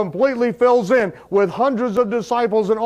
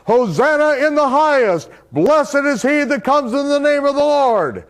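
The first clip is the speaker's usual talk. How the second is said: raised, higher praise cry; 7 semitones higher than usual. Retold or imitated. Disciples hailing Yeshua at the gate, a raised cry. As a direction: call it out like a crowd hailing a king. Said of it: "his disciples in unison begin crying out".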